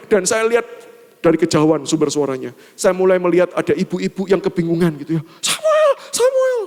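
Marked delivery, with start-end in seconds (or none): 5.4-6.6 repeated falsetto call, mimicking mum; shrill mother's call. Confused mother searching for her son, shrill imitated call. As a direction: call out in mum's piercing voice.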